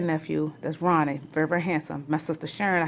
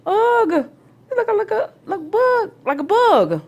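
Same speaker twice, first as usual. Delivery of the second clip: high, loud, whiny "ugh, you look like a bug"; squealing mock disgust; teasing aunt; 9 dB louder, slower than usual. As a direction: tease a little niece with bug eyes. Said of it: "I used to say".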